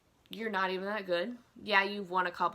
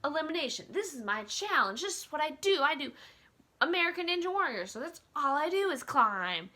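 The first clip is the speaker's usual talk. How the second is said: mock boast; 6 semitones higher, 4 dB louder than usual. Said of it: "and she was like".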